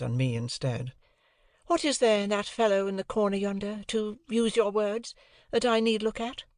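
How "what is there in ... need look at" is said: put-on lighter, higher young lady's voice; cool, airy society girl; a lightly raised ladylike voice; Estella.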